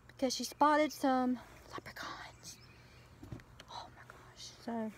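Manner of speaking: whispered secret about leprechauns. Real, not acted. Secret surprise for her daughter.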